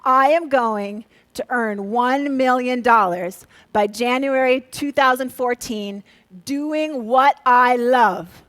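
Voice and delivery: spoken slowly, word by word, with rising phrase ends; slow cadence demo. Shown as a demonstration. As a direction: lay out the rhythm slowly. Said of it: "The cadence is gonna go".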